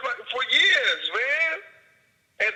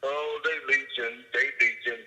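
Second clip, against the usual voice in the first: mocking; 8 semitones lower than usual. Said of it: "And everybody else is just".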